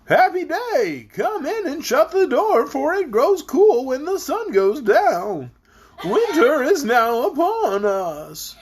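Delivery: high, slow voice bobbing up and down; bumpy voice. A narrator's reading. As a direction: make every word wobble over bumps. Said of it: "said in a rather bumpy voice".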